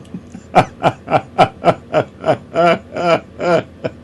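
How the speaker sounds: long staccato cackle at own joke; real helpless laughter. Real, not acted. Host cracks up at own French character, real long laugh. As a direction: laugh until the tears come. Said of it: "I haven't laughed so hard that I cried".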